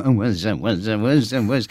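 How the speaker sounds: mock [name] slurring what's that; lazy silly [name] slur; [name] noise. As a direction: lads messing about doing [name]. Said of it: "impressions would just devolve into".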